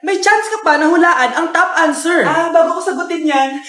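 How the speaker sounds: usual speaking voice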